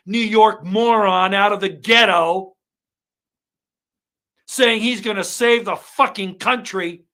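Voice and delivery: real furious shouting; furious rant. Real, not acted. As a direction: rage at the general on TV. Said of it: "I'm sorry I'm so angry tonight".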